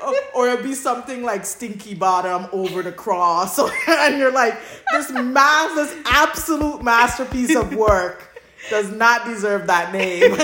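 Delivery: amused laughing rant. Real, not acted. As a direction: keep laughing while telling it. Laughing through fanfic name joke.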